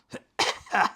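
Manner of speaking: short sneering laugh; a villain's cold chuckle; cruel laugh. A narrator's reading. Burn laughs.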